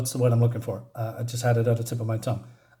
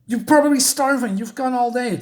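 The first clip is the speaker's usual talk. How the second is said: high, louder fretful voice; acted fussing; 10 semitones higher, 4 dB louder than usual. Character worrying that Alice is starving, high fussing voice. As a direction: fuss over them in a high voice.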